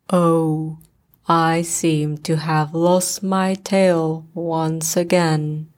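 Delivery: low, slow, gloomy donkey; Eeyore voice. A narrator's reading. Eeyore telling Pooh he lost his tail again, low and glum. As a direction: drag it low and weary.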